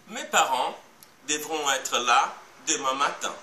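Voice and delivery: parents, là, matin each stressed at the end; word groups with strong endings on "parents", "là", "matin". Shown as a demonstration. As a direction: lean on the last syllable of "parents", "là" and "matin". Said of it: "la dernière syllabe est aussi la plus forte et longue".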